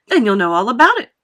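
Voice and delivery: loud, quick reply; lively showman waving off objection; Wonka, breezy retort. Acted. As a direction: toss it back briskly.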